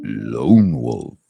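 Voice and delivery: low, sultry purr of the name; deep sultry voice. Pretend. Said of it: "in that deep, sultry voice".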